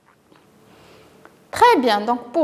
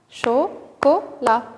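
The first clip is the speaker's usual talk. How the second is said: "Chocolat" slowly split, cho, co, la; syllable by syllable; much slower than usual. Shown as a demonstration. Teacher repeats the count for children, syllable by syllable. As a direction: tap out "Chocolat" as three separate syllables. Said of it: "de combien de syllabes se compose".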